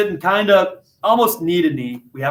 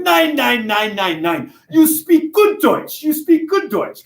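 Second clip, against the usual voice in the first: put-on German old man; 6 semitones higher, slower than usual. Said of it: "he starts going".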